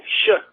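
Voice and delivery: sharp, high shush; mock shushing. Caller shushing Trump on air, mock hush. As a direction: shush someone who talks too much.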